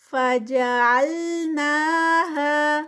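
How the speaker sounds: فَجَعَلْنَاهَا slow and loud, with نا and ہا held two counts; Quran recitation. Shown as a demonstration. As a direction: recite فَجَعَلْنَاهَا with even madd. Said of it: "نا پہ ٹو counts".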